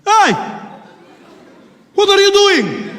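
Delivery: high, loud call in character; exaggerated outraged challenge, drawn out; challenging shout. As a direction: shout him down across the cabin, acted. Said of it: "some guy will be opposite him".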